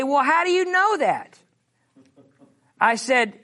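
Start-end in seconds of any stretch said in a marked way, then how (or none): 0.0-1.4 high, doubting voice; a lightly mocking skeptic asking how; skeptic's question. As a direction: play the doubter, pitched well up. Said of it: "And they say".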